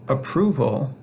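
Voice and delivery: "Approval" with the second syllable stressed. Shown as a demonstration. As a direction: lean on PROV in "Approval". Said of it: "the accent is on the second syllable".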